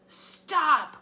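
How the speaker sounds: high, loud shout of stop; sharp yelled command. A narrator's reading. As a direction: yell to halt the throw. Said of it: "I yelled".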